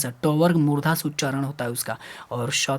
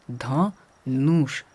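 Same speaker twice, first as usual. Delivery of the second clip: slow drawn-out word; much slower than usual. Shown as a demonstration. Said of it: "मूर्धन्य श बच गया है".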